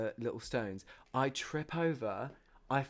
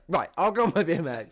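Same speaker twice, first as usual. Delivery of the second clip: mock loud friend; 5 semitones higher, 10 dB louder than usual. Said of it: "She's like".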